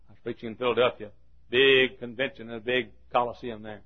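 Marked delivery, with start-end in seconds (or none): none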